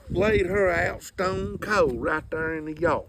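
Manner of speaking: ordinary talk, nothing marked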